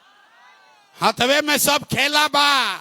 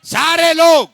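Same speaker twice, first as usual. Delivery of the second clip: loud call to the crowd; 6 semitones higher, 7 dB louder than usual. Real, not acted. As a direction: shout it to the back rows. Calling out to the whole crowd.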